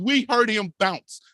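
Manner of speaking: normal talking voice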